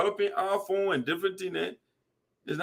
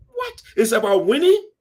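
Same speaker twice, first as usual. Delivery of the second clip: high, sharp disbelief; shocked; 5 semitones higher, 5 dB louder than usual. Real, not acted. Reacting to leaders boasting they won, shocked. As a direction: thrown by the absurdity.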